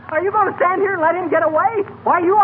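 high, heated protest; angry. Acted. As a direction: argue hotly with the cop. Arguing with the policeman.

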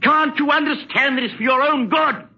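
impatient, high-pitched insistence; exasperated scolding. Acted. Exasperated husband scolds his wife.

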